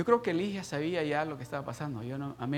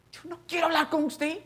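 high, strained-up protest; angry refusal; 14 semitones higher, 5 dB louder than usual. Retold or imitated. Father rejecting the assistant who came out, angry refusal. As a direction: throw it back in a high voice.

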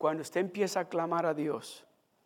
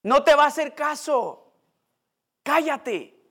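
scolding; 8 semitones higher, 6 dB louder, slower than usual. Acted. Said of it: "¿Qué le decían?".

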